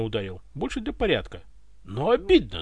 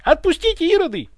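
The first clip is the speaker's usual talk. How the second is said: high, loud command; yelling; 9 dB louder than usual. A narrator's reading. Butler orders guards to release the tsar, yelling. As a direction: shout at them, pitched very high. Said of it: "заорал подоспевший дворецкий".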